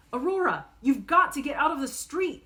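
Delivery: high, loud frantic yell. Acted. Sister yelling at her little sister in the street, loud. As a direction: shout at her to get out. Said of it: "I yell".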